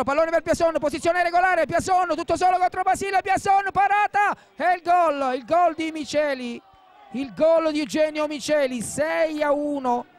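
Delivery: high, loud, roaring goal call; excited goal shout. Real, not acted. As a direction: yell the goal at full voice. Goal call in live commentary.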